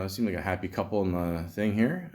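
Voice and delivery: normal talking voice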